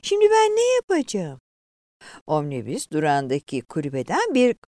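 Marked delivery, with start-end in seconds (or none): 0.0-1.4 high-pitched tearful cry; acted loud weeping plea; wailing. A narrator's reading. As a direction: lament helplessly in a high voice. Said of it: "yüksek sesle ağlamaya başladı".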